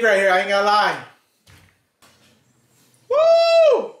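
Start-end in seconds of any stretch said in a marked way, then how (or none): 3.1-3.9 excited whoop. Real, not acted. Reacting to hot sauce.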